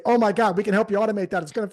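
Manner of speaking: loud, gushing mock excitement; over-eager sales pitch. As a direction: burst in like an overexcited salesperson. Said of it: "You're not gonna say".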